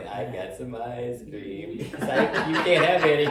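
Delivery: teasing comic taunt, jumping loud and high; drawn-out tease bursting into a loud, laughing finish; mock-gloating comedian bit; Eddie Murphy. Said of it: "like Eddie Murphy would say".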